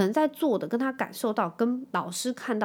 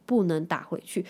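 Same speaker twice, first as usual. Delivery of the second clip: normal talking voice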